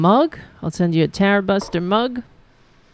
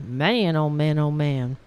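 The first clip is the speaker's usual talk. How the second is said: ordinary talk, nothing marked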